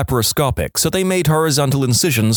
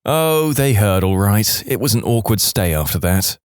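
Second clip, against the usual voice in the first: plain reading voice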